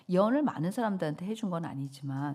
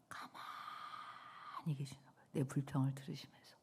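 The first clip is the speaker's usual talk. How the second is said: hushed whisper; 5 semitones lower, 12 dB quieter than usual. Acted. Preacher telling the congregation of God's silence, in a whisper. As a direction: hush the room like a secret.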